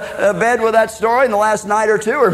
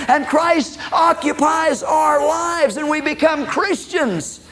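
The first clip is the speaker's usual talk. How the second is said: higher, rising sermon cadence that swells to a shout; preaching at full force; 7 semitones higher than usual. Acted. Preacher urging the congregation toward Christ, rising fervent preaching. Preaching climax about faith.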